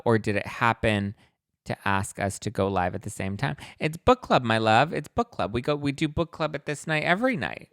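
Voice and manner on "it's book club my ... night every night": louder, higher, sing-song it's book club; teasing, mock-patient reply; sassy correction. Real, not acted. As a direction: set the commenter straight playfully. Answering chat comment.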